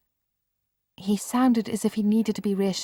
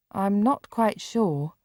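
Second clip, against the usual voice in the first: slow and hesitant; a boy slowly weighing it; 4 semitones higher, slower than usual. Acted. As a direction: draw each word out, unsure. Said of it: "Mark said slowly".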